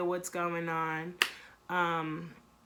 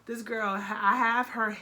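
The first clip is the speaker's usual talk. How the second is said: usual speaking voice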